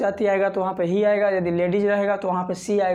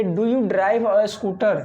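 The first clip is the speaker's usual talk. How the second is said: very slow, word by word, for learners; slow teacher read; much slower than usual. Shown as a demonstration. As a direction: spell each word out slowly like a teacher. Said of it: "दोस्तों आप ध्यान रखिए". (धीमे-धीमे, हर शब्द साफ़ बोलते हुए)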